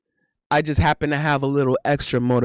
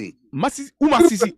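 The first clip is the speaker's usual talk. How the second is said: put-on Haitian accent; 7 semitones higher, 4 dB louder than usual. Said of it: "Haitian accent when they're like".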